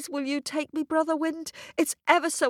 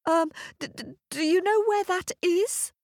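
put-on much higher, timid stammering girl; a frightened girl stammering her question; stuttering, shivering; 8 semitones higher than usual. Cold, scared girl asking the North Wind, stuttering. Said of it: "she stuttered, shivering".

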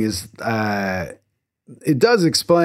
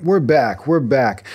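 ordinary delivery

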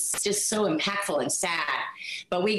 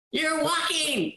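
shrill nagging mom; 10 semitones higher, slower than usual. Retold or imitated. Continues mom impression.

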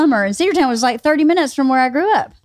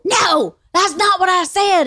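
high-pitched mock yell, little added volume; imitated coach's yell; 9 semitones higher than usual. Trainer correcting her friend in her retelling, mock yelling.

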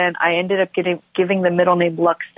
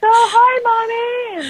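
high-pitched imitation of excited children; hammed-up kid voice, very high; excited little kids; much slower than usual. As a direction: squeal a greeting like a small boy. Voicing her excited boys.

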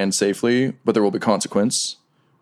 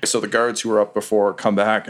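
ordinary delivery

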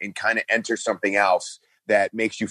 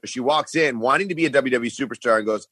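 normal talking voice

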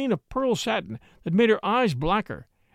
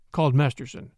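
usual speaking voice

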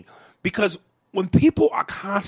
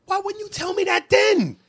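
much higher, exasperated question; an exasperated, much higher challenge to his friend; heated demand; 12 semitones higher, 5 dB louder than usual. Real, not acted. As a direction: push him hard for an answer.

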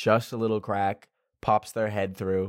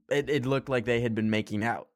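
ordinary talk

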